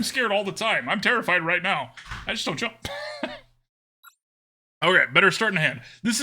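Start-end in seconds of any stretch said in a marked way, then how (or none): 2.8-3.4 quiet, very high giggle; high laugh. Real, not acted. After admitting he just doesn't jump, high laugh. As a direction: laugh softly, pitched right up.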